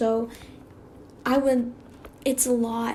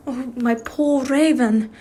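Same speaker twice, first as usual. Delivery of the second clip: put-on slow, louder "Raven" in an unplaced accent; drawn out in an accidental put-on accent; reading a tender line in a stray accent; odd accent; 5 dB louder, slower than usual. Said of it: "Don't know where that accent came from".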